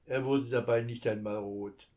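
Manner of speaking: ordinary delivery, nothing marked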